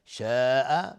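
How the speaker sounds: شاء with the alif stretched four to five counts; long madd. Shown as a demonstration. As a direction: draw out the vowel of شاء long. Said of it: "مد واجب متصل". (کشیدن مد شاء به اندازه چهار حرکت)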